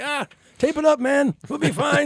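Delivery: very high-pitched put-on character voice; comic high-pitched impression of a tough-it-out guy; Stooge voice. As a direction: squeal it way up high as a goofy Stooge. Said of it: "that's my Stooge voice".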